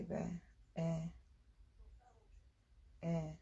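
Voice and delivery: low, soft voice on a call; phone call. Real, not acted. Ending on a private phone call, low and soft. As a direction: talk quietly into the phone.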